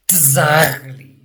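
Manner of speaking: usual speaking voice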